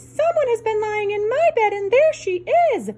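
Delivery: put-on very high baby bear voice; baby bear piping high and small; wee small voice. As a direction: squeak it out, tiny cub. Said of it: "in his wee small voice".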